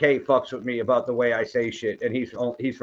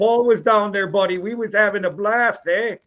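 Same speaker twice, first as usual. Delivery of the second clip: mock Canadian accent pitched much higher; 8 semitones higher, 3 dB louder than usual. Host imitating Upper Michigan locals in a hammed-up Canadian accent. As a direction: lay on the Canadian accent, buddy and eh. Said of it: "they talk like Canadians".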